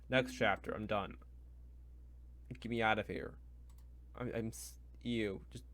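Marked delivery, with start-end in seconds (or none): none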